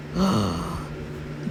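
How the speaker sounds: long, soft, low gasp; admiring gasp. Real, not acted. Player seeing how handsome Daddy is, admiring gasp. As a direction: gasp as if smitten by what you see. Reacts to handsome character on screen.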